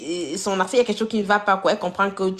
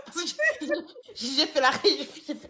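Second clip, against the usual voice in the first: parler à travers le rire, plus aigu; phrase hachée par le rire; en riant; 7 semitones higher than usual. Real, not acted. Amusée, rit en racontant. (en riant, amusée)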